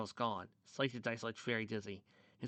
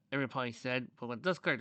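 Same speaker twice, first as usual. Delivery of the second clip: ordinary delivery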